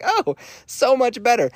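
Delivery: high mock-sarcastic. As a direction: say it dripping with sarcasm. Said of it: "I was like".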